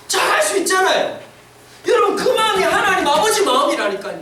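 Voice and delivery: ordinary delivery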